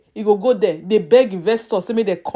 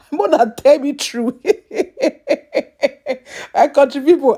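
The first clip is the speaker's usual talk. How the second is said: laughing through the words; 2 semitones higher than usual. Real, not acted. Laughing to fellow countrymen, laughing. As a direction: chuckle while you talk.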